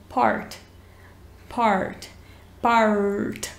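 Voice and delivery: American party with a clear R; American accent. Shown as a demonstration. Teacher demonstrating the American R. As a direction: part said with the R sounded. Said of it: "em inglês americano".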